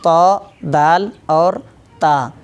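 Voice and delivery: तो, दाल और ता said slowly and deliberately; letter names, carefully. Shown as a demonstration. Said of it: "ये तीन हरूफ अदा होंगे".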